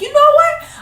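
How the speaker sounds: very high, loud crying declaration; high loud tearful kid; crying child outburst. Retold or imitated. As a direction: wail it like a furious kid. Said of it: "I was like".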